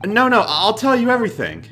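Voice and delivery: panicked high plea. Acted. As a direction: beg for your life in panic. Voicing a panicked game character.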